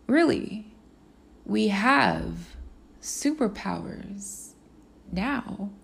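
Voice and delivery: slow, softened, with pauses between phrases; slow emphatic repeat. Real, not acted. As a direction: let each phrase sink in, slowly and quietly. Repeats her line for emphasis.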